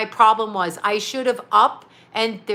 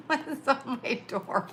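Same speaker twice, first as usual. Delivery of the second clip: laughing; 3 semitones higher, 9 dB quieter, slower than usual. Real, not acted. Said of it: "laughs, repeats question".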